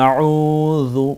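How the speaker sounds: আউযু with a soft z-like ذ, slow and drawn out; আউযু recited slowly in Quranic style; soft zal. Shown as a demonstration. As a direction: recite আউযু, tongue tip lightly on the teeth. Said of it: "মিলিয়ে নরম করে বলতে হয়".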